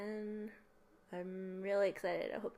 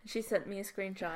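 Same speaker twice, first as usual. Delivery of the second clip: ordinary talk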